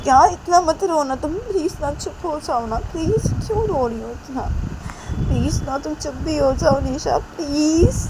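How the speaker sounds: high-pitched, insistent begging; acted imploring, leaning on each please; pleading. A narrator's reading. Manisha begging Nisha to stop crying, pleading.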